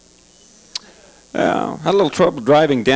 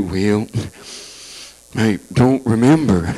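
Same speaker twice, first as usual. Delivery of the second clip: much lower, slow forgetful reply; Reagan impression; 6 semitones lower, much slower than usual. Reagan asked about Nancy, low slow vague answer. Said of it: "Probably have an answer for that".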